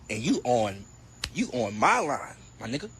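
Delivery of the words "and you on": normal talking voice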